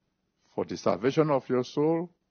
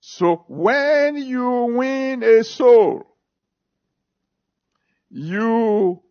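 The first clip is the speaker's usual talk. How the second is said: high, loud, slow proclamation; preaching cry; 11 semitones higher, 7 dB louder, much slower than usual. Real, not acted. Preacher crying out to the congregation, high and loud. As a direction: stretch it out and lift it high.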